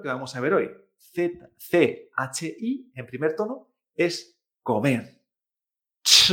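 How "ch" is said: CH with a loud burst of air; aspirated ch. Shown as a demonstration. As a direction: let the air explode out on CH. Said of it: "tiene que notarse esa explosión de aire".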